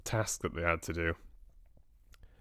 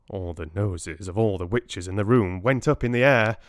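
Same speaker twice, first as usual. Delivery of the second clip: usual speaking voice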